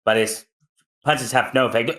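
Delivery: ordinary delivery, nothing marked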